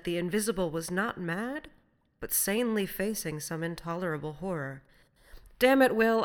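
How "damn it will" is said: loud, raised outburst; vehement sympathy. A narrator's reading. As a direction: burst out, moved with pity. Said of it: "full of many feelings over which predominated a vast sympathy".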